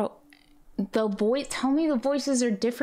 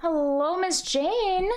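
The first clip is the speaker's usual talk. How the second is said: high sing-song greeting; 7 semitones higher, much slower than usual. Acted. Greeting a character in game.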